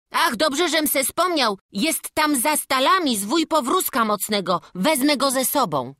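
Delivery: put-on lively boy struck by an idea; eager boy. Boy tells master of the rope he remembered, eager. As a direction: speak up keenly as the young climber.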